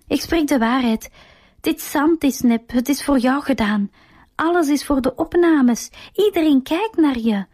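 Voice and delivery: a hint of desperate yelling; mild shout. A narrator's reading. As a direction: Sylvia crying out while pulled away, mildly voiced. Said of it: "schreeuwt Sylvia".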